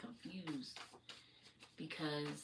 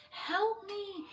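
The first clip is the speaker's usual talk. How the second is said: high mock scream for help, much louder; imitated scream; 8 dB louder than usual. Retelling a woman screaming by the tracks, high imitated scream. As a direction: echo the woman's piercing cry for help. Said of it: "literally was screaming".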